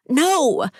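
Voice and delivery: a high, loud no as the car door shuts; desperate cry. A narrator's reading. Trapped woman crying out at her abductors. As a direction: cry out against being shoved in. Shoved into the back seat.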